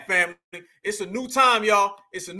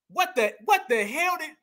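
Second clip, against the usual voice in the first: normal talking voice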